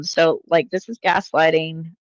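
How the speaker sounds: ordinary talk, nothing marked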